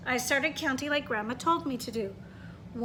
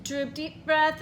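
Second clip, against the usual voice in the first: ordinary delivery, nothing marked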